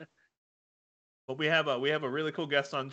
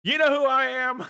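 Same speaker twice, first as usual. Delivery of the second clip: loud, high, demanding customer; indignant shout; 9 semitones higher, 6 dB louder than usual. Acted. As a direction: yell it like an entitled customer. Angry DMV customer acted out.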